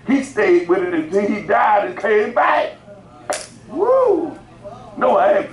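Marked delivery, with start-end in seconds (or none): 0.1-2.8 raised-pitch pulpit shout, building; rising preacher's shout. Real, not acted. 3.7-4.4 high-pitched "woo" of praise; exultant whoop. Real, not acted. Exults after preaching climax.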